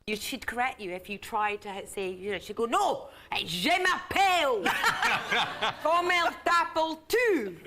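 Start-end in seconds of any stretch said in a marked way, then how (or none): 2.7-7.6 put-on Scottish-accented schoolroom French, higher and louder; French teacher correcting in a Scottish accent. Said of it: "did French in a Scottish accent".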